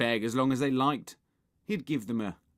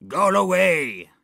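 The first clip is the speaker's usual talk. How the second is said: slow, loud, raised-pitch zombie yell; zombie shout; 8 semitones higher, 12 dB louder than usual. A narrator's reading. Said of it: "shouted the zombie".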